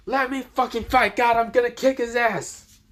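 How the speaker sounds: high-pitched ranting demand; furious yelling. Acted. Hero demanding weapons to fight God, high furious yell. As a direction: rage at the heavens, voice pushed way up.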